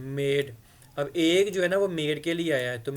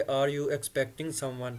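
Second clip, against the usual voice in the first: slow model reading; much slower than usual. Shown as a demonstration. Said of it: "तो फिर आप उसको ऐसा कहोगे".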